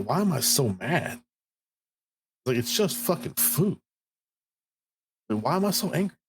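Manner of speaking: mock exasperation with yourself; retold baffled self-questioning. Retelling baffled self-questioning about anger.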